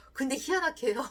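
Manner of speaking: ordinary delivery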